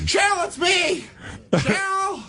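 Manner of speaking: very high, slow, shouted cry into the phone; hammed-up squeaky plea. Pleading with the ex over the phone, very high hammed-up shout. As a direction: whine her name like a lovesick fool. Acted girlfriend's boyfriend bit.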